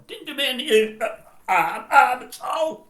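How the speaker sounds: comic bellow that it did no harm; mock loud insistence. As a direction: overdo the defensive shout.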